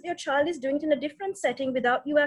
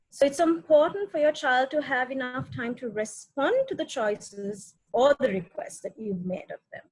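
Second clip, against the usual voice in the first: usual speaking voice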